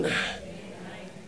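slow breathy whisper of "Day and night"; whispered answer. Acted. Preacher answering his own question to the congregation, slow whisper.

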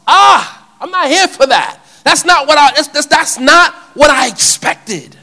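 loud, high-pitched indignant outburst; outraged protest. Acted. Peter refusing to accept Jesus yielding to the cross, outraged. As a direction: cry out in betrayed disbelief.

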